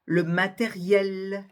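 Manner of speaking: matériel drawn out slowly with a clear final L; matériel, final L fully pronounced; sounded final L. Shown as a demonstration. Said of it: "prononcez bien cette, euh, consonne finale".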